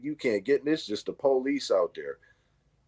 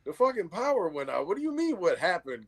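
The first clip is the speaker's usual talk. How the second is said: higher-pitched, mock exasperation; exasperated dad; 7 semitones higher than usual. Dad to daughter after the power went out, exasperated. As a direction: retell it as an incredulous comeback.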